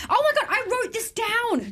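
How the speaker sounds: high excited laugh; excited gasp. Real, not acted. Excited recognition, laugh heard.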